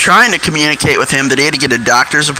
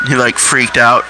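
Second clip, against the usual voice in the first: ordinary delivery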